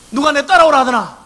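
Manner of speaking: a leader snapping at complainers; mock scolding. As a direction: Moses imagined scolding the people, high and loud. Moses could scold the people.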